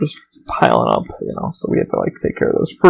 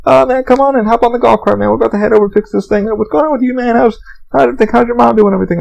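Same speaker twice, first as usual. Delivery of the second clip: higher, upbeat buddy voice; friendly tech guy; 8 semitones higher than usual. Retold or imitated. Tech in a golf cart hailing a passing friend, cheery and higher. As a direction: call out cheerfully like a pal offering a lift.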